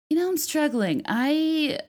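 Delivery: groggy after drinking; rough morning-after voice; hungover. Real, not acted. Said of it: "I'm so hungover".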